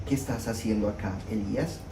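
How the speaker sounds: low, soft voice of God asking. Acted. God's voice quoted to Elijah.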